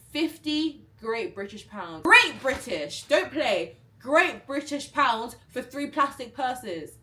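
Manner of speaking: loud, slow, every word punched; slow loud indignant rant; outraged emphasis. Real, not acted. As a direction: hammer home the outrage. Outraged at scam price.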